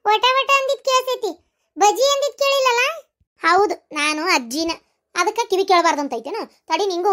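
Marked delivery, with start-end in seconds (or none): 0.1-3.1 teasing. Acted. Teasing grandma about selective hearing.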